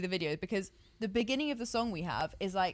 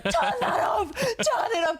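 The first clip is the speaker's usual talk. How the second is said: high, louder cry through a laugh; urgent mock shout, pitched up; retold scream; 4 dB louder than usual. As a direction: imitate her yelling in alarm, half laughing.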